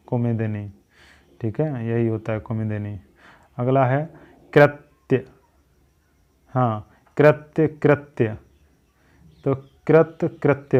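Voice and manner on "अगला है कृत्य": ordinary delivery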